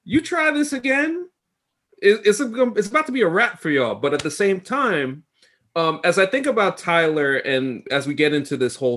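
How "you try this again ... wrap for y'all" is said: high, cool mock Wanda with her accent; a calm warning to the agents in Wanda's accent; imitating Wanda's accent. Wanda warns the soldiers at Westview's edge, in her accent. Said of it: "full accent".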